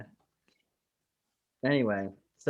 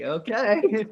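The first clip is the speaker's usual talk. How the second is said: mock baffled okay; 11 semitones higher, 8 dB louder than usual. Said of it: "mock reaction".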